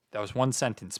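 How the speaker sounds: ordinary talk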